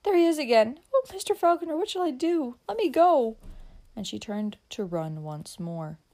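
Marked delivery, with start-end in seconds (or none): 0.0-3.4 put-on high, loud, frantic girl; terrified pleading, pitched right up; panicked cry. A narrator's reading. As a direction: cry out in panic to get away. Terrified girl sees the man again.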